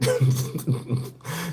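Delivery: soft, breathy laughing fit. Real, not acted. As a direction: dissolve into quiet laughter. Cracks up at petty joke.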